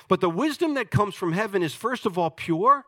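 normal talking voice

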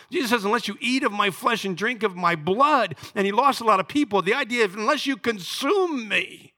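high, loud, driving sermon voice; full-force preaching. Acted. Pastor urging the congregation to confess Jesus, loud and high.

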